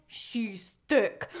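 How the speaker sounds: low, slow dim-witted mumble; dopey low voice. Retold or imitated. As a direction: drop the voice way down, dumb and lovestruck. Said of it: "you go".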